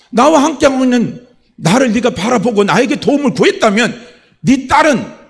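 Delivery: loud, high, forceful reproach; Holy Spirit's rebuke. Retold or imitated. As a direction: preach it as God confronting someone. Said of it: "성령께서 깨닫게 해주신 게".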